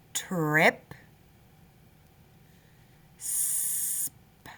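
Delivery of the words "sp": soft unvoiced whisper. Shown as a demonstration. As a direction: breathe the word out. Reviewing spelling words.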